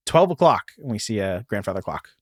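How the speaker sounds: normal talking voice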